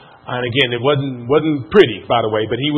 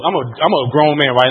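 ordinary delivery

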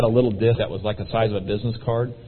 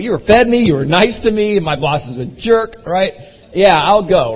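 loud, high, emphatic retold reply; fired-up agreement; 7 semitones higher, 7 dB louder than usual. Frustrated salesman agrees to the meeting, fired up. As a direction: act out an indignant, emphatic yes.

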